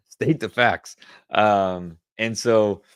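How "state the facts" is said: laughing factual repeat. Shown as a demonstration. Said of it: "Don't sound dramatic".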